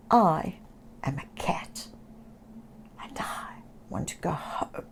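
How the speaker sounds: soft unvoiced lonely whisper. Lonely Oscar whispering to himself, soft sad whisper. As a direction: whisper it to yourself, all alone. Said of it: "he whispered".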